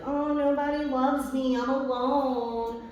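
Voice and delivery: high, drawn-out mock self-pity. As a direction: whine it like a pitiful martyr. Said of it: "one of my biggest things in life is, like".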